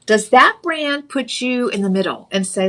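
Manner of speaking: ordinary delivery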